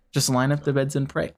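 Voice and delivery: ordinary talk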